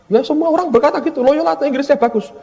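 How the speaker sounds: naśladuje kolegę. Retold or imitated. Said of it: "Teman saya ngomong gini".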